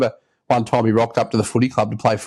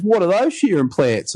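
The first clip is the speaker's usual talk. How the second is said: higher-pitched take on a curious lad; imitated young kid; 7 semitones higher than usual. Young novice asks mentor about the shearing plants, imitated kid voice. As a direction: imitate a kid asking, voice lifted. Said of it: "This is how he talks".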